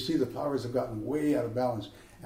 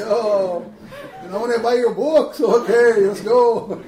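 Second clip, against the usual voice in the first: high, loud, laughing through it; laughing fan; 8 semitones higher, 10 dB louder, slower than usual. Retold or imitated. Fans beg to buy the poet's books, laughing high and loud. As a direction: gush and giggle like a tipsy fan. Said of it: "And they're like".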